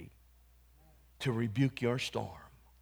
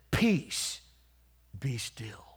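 gentle calming command; 3 semitones lower, much slower than usual. Retold or imitated. Jesus calming the storm, said softly.

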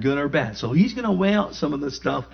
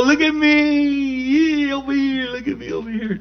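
show-off; 12 semitones higher than usual. Pretend. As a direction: ham up a bragging believer. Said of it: "Don't go".

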